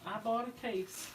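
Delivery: normal talking voice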